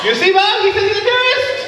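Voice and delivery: high, sarcastic officer telling Bob; scoffing at the tourist claim; mocking officer. Officer mocking the traveler to his colleague Bob, high and sarcastic.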